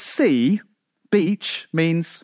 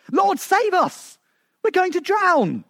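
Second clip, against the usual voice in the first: panicked cry; 13 semitones higher, slower than usual. Acted. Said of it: "I'm sure they shouted".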